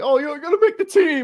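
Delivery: mock him like he thinks he's going pro; mocking sarcasm. Mocking the overeager player.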